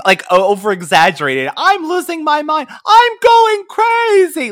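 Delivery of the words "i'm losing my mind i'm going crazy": high, slow, hammy overacted wail; bad acting. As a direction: ham it up like a terrible soap actress. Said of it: "the worst overacting you've ever seen".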